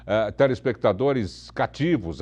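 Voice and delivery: normal talking voice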